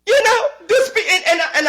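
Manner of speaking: high-pitched exasperated sputter; exasperated outburst. Real, not acted. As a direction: voice jumps way up, cracking in disbelief.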